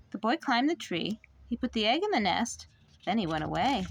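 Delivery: ordinary delivery, nothing marked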